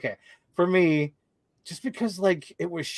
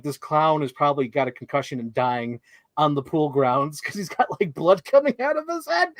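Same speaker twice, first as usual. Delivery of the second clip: normal talking voice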